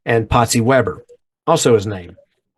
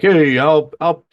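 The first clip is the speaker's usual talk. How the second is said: high, louder cool-guy brush-off; the Fonz; 4 semitones higher, 4 dB louder than usual. Retold or imitated. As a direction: play it smooth like a ladies' man. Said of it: "he'd be like".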